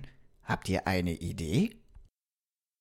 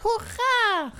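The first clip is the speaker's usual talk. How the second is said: very high joyful cheer; a tiny doll shouting for joy; Bonnie cheering; 3 dB louder than usual. Acted. Repaired doll cheering on first steps, very high. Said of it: "rief sie".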